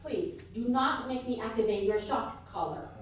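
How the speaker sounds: normal talking voice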